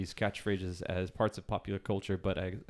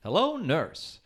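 vaudeville doctor; 6 semitones higher, slower than usual. Retold or imitated. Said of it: "the doctor always said in a doctor sketch".

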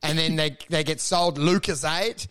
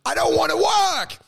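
high, loud whiny pleading; begging whine; 9 semitones higher, 3 dB louder than usual. Retold or imitated. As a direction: beg like a lazy kid who won't work.

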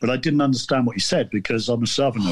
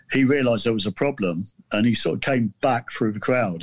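normal talking voice

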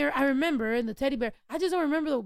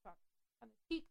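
off mic; 4 semitones lower, 13 dB quieter, much faster than usual. Real, not acted. Ducking away from the mic.

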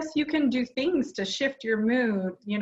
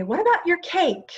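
mom's question, higher and louder, lightly acted; retold forgetful question, pitched up high; imitating mom; 9 semitones higher, 5 dB louder than usual. Daughter retelling mom asking about wedding cake, imitated high questioning voice. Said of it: "and she would say".

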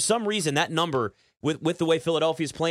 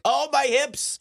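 put-on achy old man; 10 semitones higher, slower than usual. Acting out aging aches.

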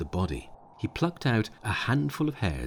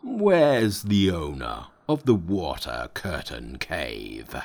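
slow, louder low bellow; loud demon call; 4 semitones lower, slower than usual. A narrator's reading. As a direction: call out the challenge slowly. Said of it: "cried in a loud voice".

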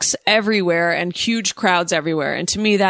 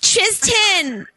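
put-on high Valley girl whine; 11 semitones higher than usual. Shown as a demonstration. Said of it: "goes so much better with that voice".

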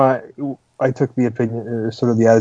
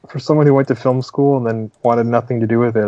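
ordinary talk, nothing marked

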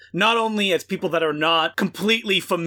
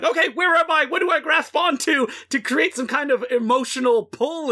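higher-pitched imitation of a lost viewer; mock bewildered viewer; 8 semitones higher than usual. Host voices a viewer lost in an abstract film, mock bewildered. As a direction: lightly act out searching for a foothold. Voicing a disoriented viewer's thoughts.

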